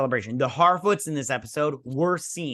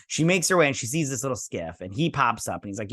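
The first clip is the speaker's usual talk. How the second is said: ordinary talk, nothing marked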